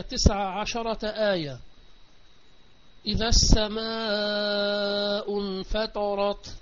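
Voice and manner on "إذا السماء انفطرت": opening line of the verse recited; Quran recitation. Acted. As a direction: intone the verse clearly.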